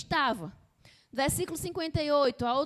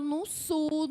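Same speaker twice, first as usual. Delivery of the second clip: ordinary delivery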